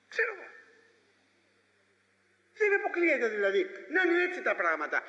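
very high, quick shrugging voice; evasive doubter. Acted. Preacher mimicking an evasive believer, high and quick shrugging. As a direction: play a dithering skeptic who won't commit.

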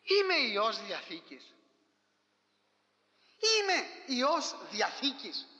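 slow, weighty proclamation; solemn declaration. Acted. Preacher declares identity slowly. (αργή, επίσημη διακήρυξη)

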